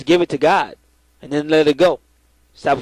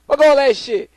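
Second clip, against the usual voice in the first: higher, impatient fed-up voice; mock irritated retort; 9 semitones higher than usual. Caller snapping back at the prayer line, higher and impatient. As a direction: brush off the praying, impatient. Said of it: "I was like".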